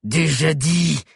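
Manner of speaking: raspy, grating savage chief. A narrator's reading. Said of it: "répondit Cro-Bleu d'une voix grinçante".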